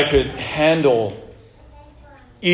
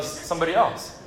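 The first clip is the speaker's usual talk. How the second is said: ordinary talk, nothing marked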